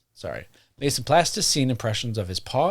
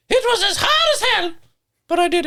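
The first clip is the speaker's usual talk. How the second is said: high cartoon complaint, louder; [name]'s voice; 5 dB louder than usual. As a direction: squeak it out like a whiny little ghost. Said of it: "he announced the next day".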